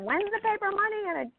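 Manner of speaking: put-on high, quavery old mother voice; imitating mom. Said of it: "my mother keeps saying".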